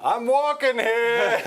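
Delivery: put-on high, slow New York accent; New York street-guy line; New York tough guy. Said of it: "that's another guy".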